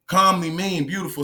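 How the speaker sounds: usual speaking voice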